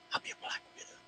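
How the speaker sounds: breathy mock whisper of the name. Host whispering a copycat's sneaky plan.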